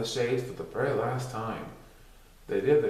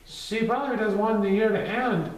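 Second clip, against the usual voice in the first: put-on child voice; 9 semitones higher than usual. Child voiced in read story.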